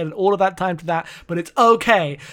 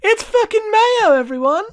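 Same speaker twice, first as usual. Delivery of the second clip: mock fan screaming; 7 dB louder than usual. Playing the comic's crowd for his co-host, high hysterical yell.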